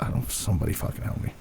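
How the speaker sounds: fast, whispered plea for help; desperate whisper. Retold or imitated. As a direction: whisper it quickly, like a frantic prayer. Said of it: "I'll be like".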